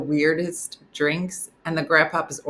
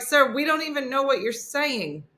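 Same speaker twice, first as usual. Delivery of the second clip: polite confused barista; 9 semitones higher than usual. Retold or imitated. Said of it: "they're like".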